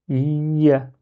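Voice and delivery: ya with a long "iyy" before the a; lingering on the shadda. Shown as a demonstration. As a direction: hold the y sound of "ya" before opening it. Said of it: "je dois m'attarder un petit peu".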